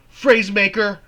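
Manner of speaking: loud, high yelled "Phrase maker!"; yelled taunt. Acted. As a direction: throw it at him. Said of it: "she yells back".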